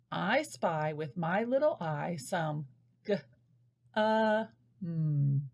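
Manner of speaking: slow turtle talk. Shown as a demonstration. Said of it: "turn Turtle Talk, Rabbit Talk into an I Spy game".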